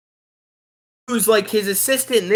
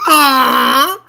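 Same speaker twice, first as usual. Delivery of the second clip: high loud mock creature shriek; loud mock monster cry; monster scream; 7 semitones higher, 8 dB louder than usual. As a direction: shriek like the putrid Muppet creature. Monster screams in movie scene.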